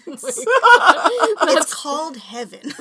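slow, cracking up mid-line; laughing. Real, not acted. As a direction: squeeze it out through laughter. Joke, laughing hard.

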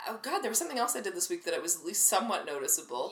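ordinary delivery

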